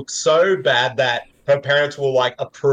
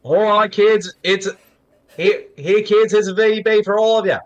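high, broad Australian parent; exaggerated Aussie accent; 6 semitones higher than usual. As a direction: call the kids over in caricature Aussie. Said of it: "even more exaggerated versions of them".

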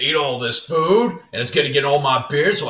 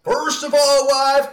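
hammed-up Southern accent yelling at his wife; Southern giant; 10 semitones higher than usual. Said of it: "this giant has a Southern accent".

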